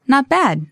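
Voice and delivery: "bad" pitched high with a clear fall; stress on BAD. Shown as a demonstration. Teacher models the correct answer, stressing "bad". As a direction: hit "bad" to sound positive. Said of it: "put kind of the stress on bad".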